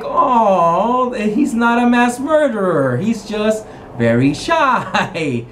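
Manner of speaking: high, drawn-out "aww" voice; cooing sympathy. Acted. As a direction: coo over someone adorably shy, stretching the last word. Players relieved, cooing over shy suitor.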